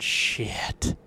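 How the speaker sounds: breathless whispered curse in the hunting blind; retold whisper. Hunter whispering to cameraman in the blind, hushed curse. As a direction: whisper it so the deer won't hear.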